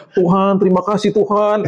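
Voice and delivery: high, careful mock prayer; over-articulated prayer. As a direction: imitate scared children praying with very neat diction. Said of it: "Doanya artikulasinya bagus-bagus".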